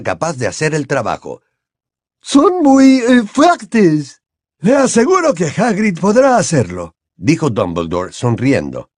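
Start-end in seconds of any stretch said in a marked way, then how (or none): 2.2-4.2 higher, slow, stately giantess; a lofty headmistress, unhurried; Madame Maxime voiced. 4.6-6.9 a raised, courteous headmaster; Dumbledore voiced.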